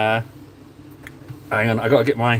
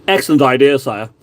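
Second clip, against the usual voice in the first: courtier impression; 3 dB louder than usual. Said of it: "excellent those impressions are".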